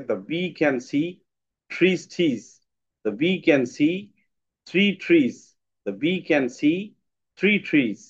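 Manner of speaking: rhythmic tongue twister, each "three trees" landing on three; tongue twister recited with a bouncing beat. Shown as a demonstration. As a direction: chant it like a drill, bee, see, three trees. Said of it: "একটা tongue twister আছে".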